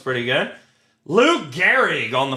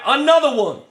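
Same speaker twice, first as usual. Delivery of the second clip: high mock hype call, pitched up; 8 semitones higher, 3 dB louder, faster than usual. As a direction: shout it like a DJ drop.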